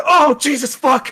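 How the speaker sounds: high, loud yelp of pain at the swab. Retold or imitated. Nose swab hurts, retold yelp.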